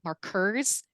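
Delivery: "markers" said mar-KERS, rising at the end; wrong-syllable stress. Shown as a demonstration. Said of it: "that sounds way weirder".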